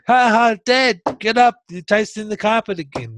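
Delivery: high, teasing mock laugh and jeer; imitating laughing kids. Kids teasing their fallen dad, imitated mock laughter. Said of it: "used to laugh their asses off".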